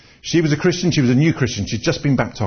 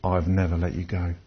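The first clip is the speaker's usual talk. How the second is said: gentle divine message; 7 semitones lower, 6 dB quieter than usual. Retold or imitated. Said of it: "And God is saying".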